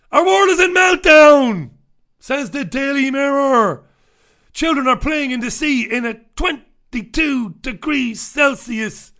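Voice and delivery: loud, high, slow hammed-up proclamation; mock-alarmed headline reading. Host reads Daily Mirror climate story to listeners, mock alarm. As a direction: declaim it like an overblown doom headline.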